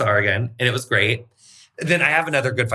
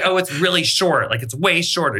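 higher-pitched mock fretting; retold freakout; 6 semitones higher than usual. Hairdresser imitating a client's freakout over short hair, mock alarm.

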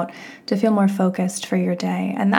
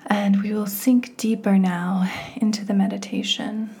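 guided meditation; 5 dB quieter than usual. Real, not acted. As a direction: guide the listener gently inward, phrase by unhurried phrase.

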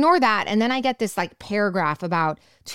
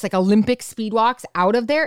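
normal talking voice

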